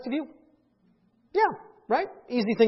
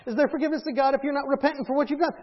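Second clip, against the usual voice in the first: normal talking voice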